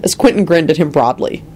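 usual speaking voice

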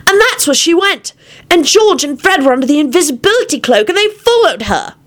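put-on high boyish voice; lightly voiced child; child Timothy. A narrator's reading. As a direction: voice the young boy, eager.

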